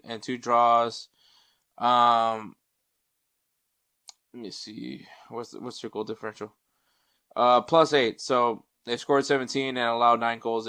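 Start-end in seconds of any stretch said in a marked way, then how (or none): 4.3-6.5 quiet, reading under breath; muttering to self. Real, not acted. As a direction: mumble it to yourself. Muttering while checking stats.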